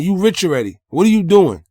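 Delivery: ordinary talk, nothing marked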